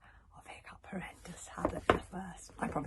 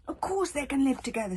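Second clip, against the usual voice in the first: loud, higher fierce protest; 10 semitones higher, 13 dB louder than usual. A narrator's reading. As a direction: push back hard at the dragon. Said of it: "said Hiccup fiercely".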